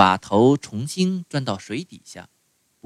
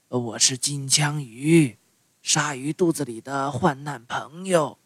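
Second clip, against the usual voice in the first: tuna voice; 7 dB quieter than usual. A narrator's reading. Tuna speaks.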